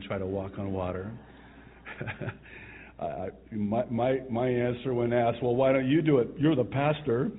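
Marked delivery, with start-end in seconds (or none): 1.8-2.9 a short unvoiced chuckle; soft breathy laugh. Real, not acted. Pastor chuckling at his Israel tour story, soft laugh. 5.4-7.3 mock challenge. Pilgrims challenge the pastor.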